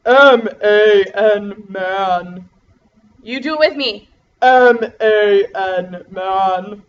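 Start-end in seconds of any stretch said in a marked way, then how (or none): 0.1-2.5 deep, slow, loud monster-like man voice. Pretend. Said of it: "What if we just talk in a man voice?". 4.4-6.8 deep, slow, loud monster-like man voice. Pretend. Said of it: "What if we just talk in a man voice?".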